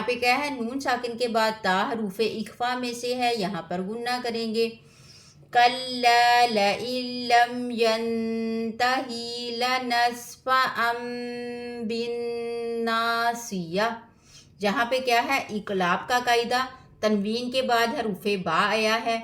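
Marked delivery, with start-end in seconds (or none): 5.5-13.9 slow, drawn-out melodic recitation; Quran recitation. Acted. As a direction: chant each verse with tajweed. (धीमी क़ुरआन तिलावत)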